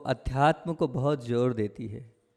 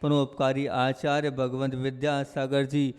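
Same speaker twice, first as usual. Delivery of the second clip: ringing call-and-response invocation, stretched endings; devotional invocation call; 8 dB louder than usual. Real, not acted. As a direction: lead the crowd in a drawn-out jaykar. Leads the crowd's invocation.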